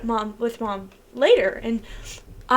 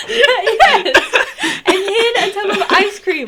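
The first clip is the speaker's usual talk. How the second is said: higher and louder through laughter; laughing; 8 semitones higher, 8 dB louder, slower than usual. Real, not acted. Sister adding the ice cream detail, laughing. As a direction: agree while cracking up.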